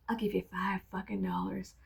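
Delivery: breathy whispered punchline; whispered aside. Real, not acted. Joking to viewers, dropping to a whisper. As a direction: whisper the offer like a secret bribe.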